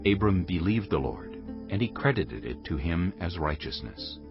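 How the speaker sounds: usual speaking voice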